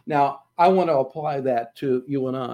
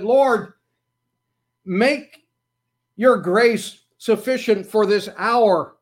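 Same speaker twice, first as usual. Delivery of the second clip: retold plea, higher and louder; crying out; 6 semitones higher, 5 dB louder, slower than usual. Grieving pastor pleading with God in prayer, loud. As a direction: call out to God in grief.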